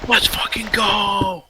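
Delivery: whispered high cheer; whisper-shout. Pretend.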